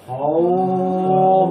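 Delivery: "হও" held long on a level note; madd at a stop. Shown as a demonstration. Teacher showing the class the pause on leen, "হও" drawn out. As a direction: draw "হও" out evenly. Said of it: "আমাকে এক আলিফ মাদ করতে হবে".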